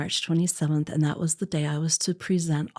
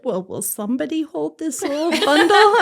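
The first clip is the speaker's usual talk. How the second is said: high, louder, chuckling doctor's line; a bright laughing nudge from the doctor; 9 semitones higher, 7 dB louder than usual. Retold or imitated. Cute doctor voiced laughing.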